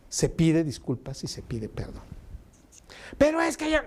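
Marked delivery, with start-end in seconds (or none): 3.2-3.8 put-on high, whining kid's excuse; whiny protest. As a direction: squeal it up high like a sulky child. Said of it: "bájale a tu prosodia".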